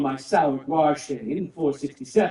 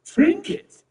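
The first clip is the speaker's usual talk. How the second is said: high and indignant: "Trinket?"; affronted, echoing the word back; indignant surprise; 14 semitones higher, 4 dB louder than usual. Acted. Host offended at his prized bow being called a trinket, indignant.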